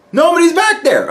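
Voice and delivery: very high, squeaky disbelief; heightened astonishment. Retold or imitated. Repeating that the back is empty, squeaky astonishment. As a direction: push it way up, amazed.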